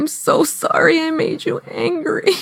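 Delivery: high, plaintive imitation of a remorseful son; genuinely sorry child. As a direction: mimic a small child's earnest apology. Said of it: "he was so genuine".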